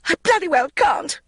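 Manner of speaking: high loud shriek. Acted. Magda to the neighbour, high panicked shriek. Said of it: "shrieked Magda".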